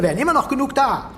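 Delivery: lauter und höher, schnell herausgestoßen; aufgebrachter Ausruf. Acted. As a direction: ereifert sich über die Leute dort. Müller steigert sich in Hetze. (steigert sich erregt in die Hetze)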